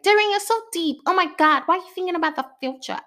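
high, teasing mock friend voice; hammed-up friend impression; mimicking friends. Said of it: "I'm mimicking a person, like, a particular person's voice".

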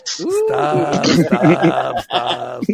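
cracking up, stop fading; laughing. Real, not acted. Begging friends to stop joking, laughing. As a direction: plead through laughter.

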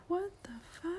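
hushed, high what the fuck; whispered shock. Retold or imitated. Husband whispering shock at wife's outburst. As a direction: breathe it out stunned. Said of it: "you're like".

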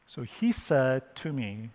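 ordinary delivery

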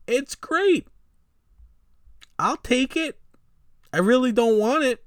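a sarcastic imitation of someone settling for a deal; sarcastic mock voice. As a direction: put on a grudging fan's voice. Mock reluctant acceptance of contract.

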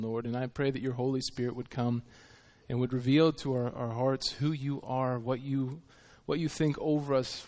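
hushed pastor's prayer. Acted. Leads an opening prayer.

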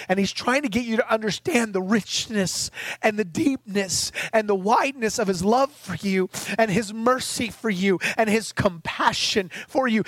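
normal talking voice

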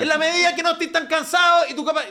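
high, loud, overly polite coach; a coach far too nice to his player; mock polite coach. Coach politely asks a tired winger, in a high mock voice.

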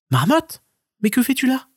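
high, surprised stoat call; stoat exclaiming. Acted. Stoat spots the lost marmot, high exclaiming call. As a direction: call out high and bright to a friend. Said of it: "il s'écria".

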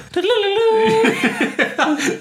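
squealing laugh; high laugh. Real, not acted. Laughing at Zucko.

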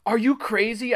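high-pitched alarmed shriek. A narrator's reading. Narrator stops Greg lifting the lid, shrieking. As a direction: shriek in disbelief. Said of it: "I shrieked".

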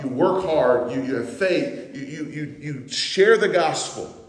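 high, loud, rising preacher's cry; preaching at full force. Acted. Preacher voicing Paul to his congregation, fervent and loud. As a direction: drive Paul's words home to the church.

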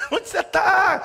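high, loud call with a laugh; shouting. Retold or imitated. Disciples searching for her in the dark, laughing shout. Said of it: "Todo mundo gritando".

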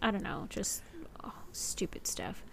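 unvoiced embarrassed whisper; whispered aside. Real, not acted. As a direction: drop to a sheepish whisper. Trailing off embarrassed.

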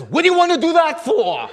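high, loud Italian papa; a hammed-up Italian papa, high and loud; café owner voice.

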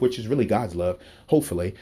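usual speaking voice

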